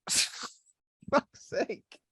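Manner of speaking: helpless laughter breaking into the words; laughing. Real, not acted. Quizmaster apologising for cracking up mid-question, laughing. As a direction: try to apologise through the giggles. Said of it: "I'm just laughing".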